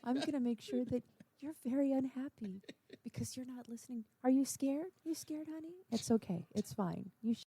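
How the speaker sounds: a parent's hushed scary warning; menacing quiet threat. Retold or imitated. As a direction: threaten them calmly under your breath. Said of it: "Finally, I would just go".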